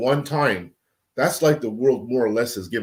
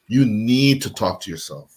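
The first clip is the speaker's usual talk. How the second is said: ordinary talk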